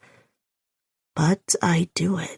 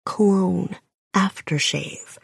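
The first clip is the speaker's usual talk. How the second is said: plain reading voice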